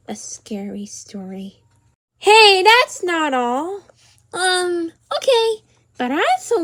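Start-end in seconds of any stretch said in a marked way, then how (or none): 0.1-1.6 whisper. Pretend. Kid asking for scary story.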